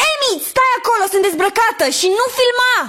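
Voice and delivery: high-pitched, alarmed yell; startled yelling. Acted. Woman caught undressed by friend with camera, yelling. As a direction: yell at an intruder to stay back. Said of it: "nu mai țipa așa".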